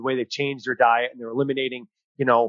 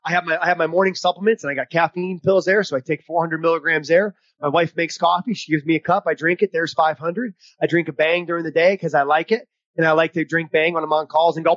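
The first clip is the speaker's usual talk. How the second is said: ordinary talk, nothing marked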